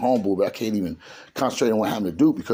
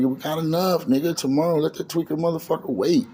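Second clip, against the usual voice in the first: higher-pitched, acted-out pleading to let it wait; insistent urging; 8 semitones higher than usual. Narrator urging Duke to wait until tomorrow, insistent. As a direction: talk a friend out of going, voice raised in pitch. Retold urging friend not to go.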